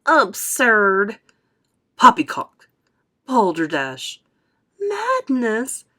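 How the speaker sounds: loud scornful exclamations; rising chorus of shouts; shouted. A narrator's reading. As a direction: fling each word out in protest. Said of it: "they shouted".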